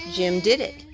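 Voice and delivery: plain reading voice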